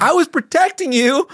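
high-pitched, hammed-up self-justification; mock defensive protest.

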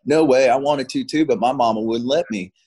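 lightly imitated thick Southern accent; a hint of a Southern US accent. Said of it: "thick Southern accent behind me".

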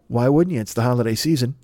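ordinary delivery

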